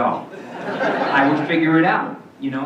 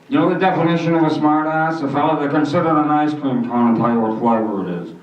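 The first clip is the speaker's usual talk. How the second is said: put-on corny old comic; 2 semitones higher, 5 dB louder than usual. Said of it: "He was like".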